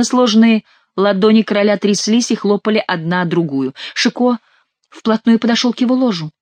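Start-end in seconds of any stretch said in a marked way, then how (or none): none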